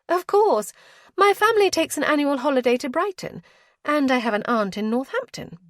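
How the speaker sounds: put-on young lady flattering the earl, high and gushing. A narrator's reading. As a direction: flatter him with bright eager gush. Lady boasts of travel.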